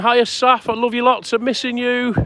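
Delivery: sweetly greeting someone he misses; tender greeting. Real, not acted. Greeting Saf watching his stream, tender and affectionate.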